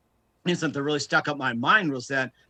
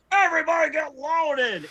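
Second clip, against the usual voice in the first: much higher, shouted ballpark announcer cheer; Harry Carey; 13 semitones higher than usual. Retold or imitated. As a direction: bellow it like a tipsy baseball broadcaster. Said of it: "and here he is".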